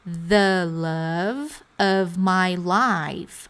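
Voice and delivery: The love of my life drawn out word by word; slow model phrase; very slow chunk. Shown as a demonstration. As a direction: stretch the phrase slowly. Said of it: "Quem aqui no caso?".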